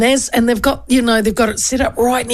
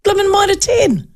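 laughing; 12 semitones higher than usual. Real, not acted. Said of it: "Who was I giving that?".

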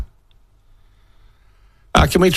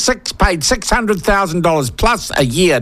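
outraged disbelief; 7 semitones higher than usual. Real, not acted. Radio host outraged at chief executive's salary. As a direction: spell out the outrage, word by word. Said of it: "more angry I am becoming".

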